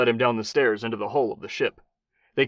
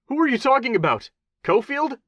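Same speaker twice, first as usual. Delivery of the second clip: high, panicked blurt; 14 semitones higher, 5 dB louder than usual. A narrator's reading. Attorney General blurting in panic at gunpoint, high. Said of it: "Handler blurted".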